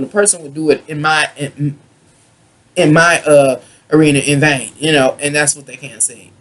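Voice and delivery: tipsy rambling, tripping over words. Real, not acted. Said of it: "this is a drunken rant".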